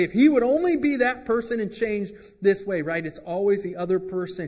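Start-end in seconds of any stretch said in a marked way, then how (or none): 0.0-2.8 higher, louder mock complaint; mock blaming wife. Wife in a marital dispute blaming her husband, mock exasperated. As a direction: gripe about the husband as a fed-up wife. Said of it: "The wife is always gonna say".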